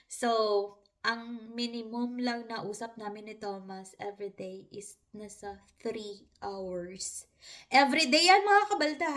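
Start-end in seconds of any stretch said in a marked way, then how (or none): none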